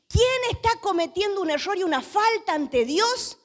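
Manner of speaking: high-pitched, insistent interrogation; accusing demand. Acted. Preacher as sailors asking who offended God, accusing. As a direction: press the question on the whole group, theatrically.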